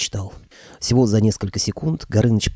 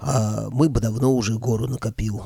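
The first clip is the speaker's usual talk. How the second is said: ordinary delivery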